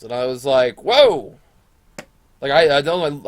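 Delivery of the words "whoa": high, loud startled exclamation; acted shock. Reacting to the principal's accusation, loud mock shock. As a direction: blurt it out as if taken aback.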